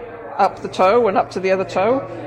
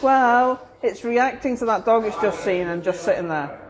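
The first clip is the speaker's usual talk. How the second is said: dawning realization; 5 semitones higher, slower than usual. Retold or imitated. Owner realizing, trying again.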